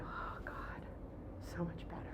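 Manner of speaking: soft, whispery sigh of relief; retold relief. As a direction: let it out under your breath, relieved.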